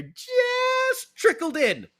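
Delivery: high, stretched-out celebration cry; whooping as the winner goes in; excited goal call. Acted. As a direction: draw it out like a jubilant announcer. Re-enacting the winning goal call.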